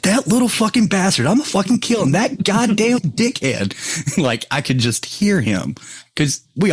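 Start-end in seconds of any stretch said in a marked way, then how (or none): 0.0-4.2 higher mock fury, ranting; imitating furious friend. Friend imagined finding out, mock furious rant. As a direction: ham up his outrage at the prank. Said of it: "he's gonna be like".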